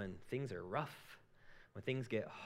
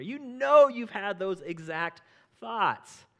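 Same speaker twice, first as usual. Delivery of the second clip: emphatic; 10 semitones higher, 13 dB louder, much slower than usual. Real, not acted. Pastor presses his point.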